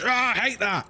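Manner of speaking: high mock ghost cry, Ah, I hate that; playful imitation of a fed-up ghost; angry ghost voice. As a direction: squeal it like an annoyed ghost. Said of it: "and it goes".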